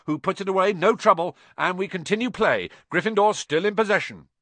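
high, loud sports commentary; excited commentator. Acted. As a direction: call the match with bias. Commentator calling the match.

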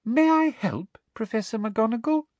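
high, sugary offer rising at the end; poisonously sweet. A narrator's reading. Intruder offering help to angry professor, poisonously sweet. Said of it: "her most poisonously sweet voice".

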